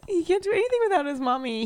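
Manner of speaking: high-pitched mock-pitying mommy voice; playful baby talk. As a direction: coo it high, like babying a dog. Plays along with mommy teasing.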